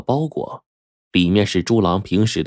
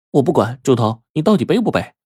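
put-on higher-pitched, impatient girl; petulant demand; 6 semitones higher than usual. A narrator's reading. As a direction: push him crossly for an answer. Girl quarrels, demanding a piggyback.

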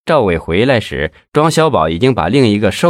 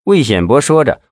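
usual speaking voice

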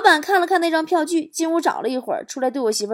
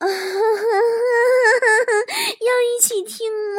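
害羞撒娇，声音高而做作; 装出少女的羞涩，轻轻问; 娇羞地问; 9 semitones higher than usual. Acted. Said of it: "我脸一红说". (脸红害羞地撒娇问)

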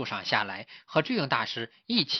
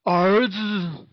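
higher, drawn-out motherly call; tiger calling out; 6 semitones higher, 3 dB louder than usual. Acted. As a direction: call across to a long-missed child. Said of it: "也朝小鹿叫道". (老虎拉长声音呼唤)

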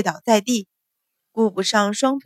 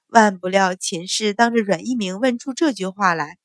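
ordinary delivery, nothing marked

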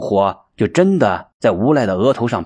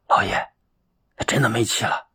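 softer, stunned report; hushed dread; 2 semitones lower, 6 dB quieter than usual. A narrator's reading. Steward telling the master the man is dead, hushed and stunned. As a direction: barely get the words out.

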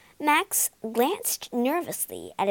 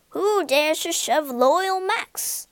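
slow, loud, higher ghostly roar; 5 semitones higher, 5 dB louder, slower than usual. A narrator's reading. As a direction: boom it out to scare the bullies. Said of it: "he roared".

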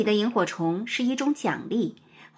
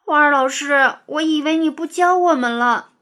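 put-on higher, plaintive child's lament; heartbroken; 4 semitones higher, 5 dB louder than usual. A narrator's reading. Pupil tells the teacher she feared being left, sad and plaintive. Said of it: "牛牛伤心地说".

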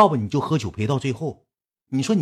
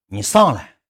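louder mimicked urging; nagging coax; 7 dB louder, slower than usual. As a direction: keep pestering her to come up. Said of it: "一遍搁那磨叽磨叽磨叽". (磨叽着催人上来)

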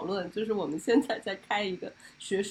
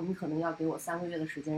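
usual speaking voice

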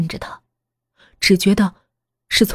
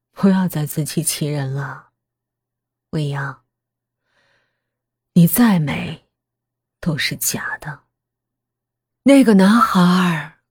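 unhurried, eerie calm. Acted. Mother tells daughter her beauty is fake, in eerie calm. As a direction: say it leisurely, haunting and composed. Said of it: "母亲悠悠地说着".

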